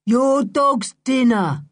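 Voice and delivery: loud character shout; yelled. Acted. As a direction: yell it out through a window, drawn out. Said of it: "he yelled".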